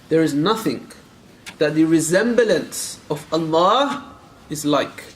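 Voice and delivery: drawn out slowly, each word weighed; slow, deliberate reading. Shown as a demonstration. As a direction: spell it out slowly so the problem is heard.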